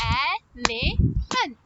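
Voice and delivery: "Elephant" in three separate beats; syllable by syllable. Shown as a demonstration. Teacher claps word with children, "Elephant" in three beats. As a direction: say El, e, phant with pauses between. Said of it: "Let's do it again".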